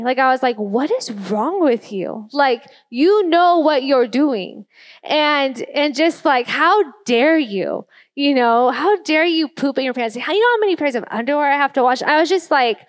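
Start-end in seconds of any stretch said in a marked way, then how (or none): 0.6-4.6 slow, exasperated scolding; retold angry parent's rant. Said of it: "I was just getting angry". 6.5-12.0 indignant scolding, stretched then rushing; angry. Retold or imitated. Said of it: "I was just getting angry".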